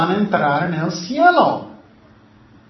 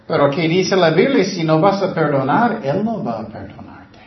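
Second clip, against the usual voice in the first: ordinary talk, nothing marked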